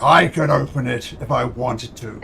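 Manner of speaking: loud, proud dragon, slowing at the end; boastful, prideful dragon. Acted. Dragon daring adventurers to open the door, prideful. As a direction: insist grandly, then issue the invitation. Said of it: "he is being boastful and prideful".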